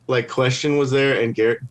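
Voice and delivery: usual speaking voice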